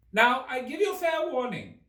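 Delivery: very high, loud, furious royal shout; shouting Queen. A narrator's reading. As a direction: bellow the warning like a tyrant queen. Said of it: "shouted the Queen".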